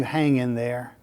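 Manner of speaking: low, soft reassurance; gentle encouragement. Real, not acted. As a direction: encourage them quietly, dropping your voice. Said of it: "can I encourage you".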